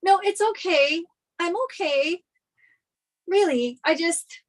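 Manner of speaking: ordinary talk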